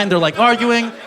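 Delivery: ordinary talk, nothing marked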